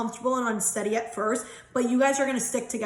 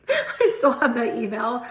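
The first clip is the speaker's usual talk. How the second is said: giggling; 4 dB louder than usual. Real, not acted. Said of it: "I love when I get in giggle moods".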